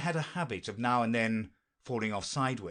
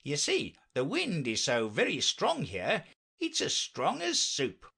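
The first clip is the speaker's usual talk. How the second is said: higher, swooping knight voice; anxious knight; 5 semitones higher than usual. Acted. Knight warns Alice of strong wind, anxious and lilting. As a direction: warn earnestly, pitch climbing.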